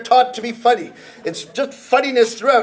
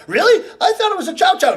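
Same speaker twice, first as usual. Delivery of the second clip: higher, brighter "Really?" in a surprised second voice; surprised asker; 8 semitones higher, faster than usual. Acted. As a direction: play the doubtful friend, pitched up. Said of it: "a conversation like this".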